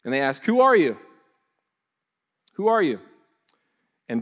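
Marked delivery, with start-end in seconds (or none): 0.4-3.0 loud, high, demanding question; demanding shout. Acted. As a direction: call it out like a challenge from the crowd. Priests demanding John's identity.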